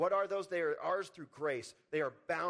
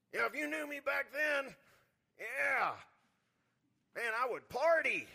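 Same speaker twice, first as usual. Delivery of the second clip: high, boastful mock-testimony voice; bragging about the old days; 7 semitones higher than usual. Preacher mocking a believer who brags about partying. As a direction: play the show-off reliving his wild past. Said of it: "those people that their testimony".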